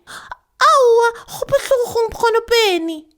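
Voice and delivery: put-on very high, loud little girl's complaint.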